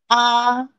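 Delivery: "A" held high and perfectly level; first tone. Shown as a demonstration. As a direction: sustain "A" on one high, flat note. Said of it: "first tone".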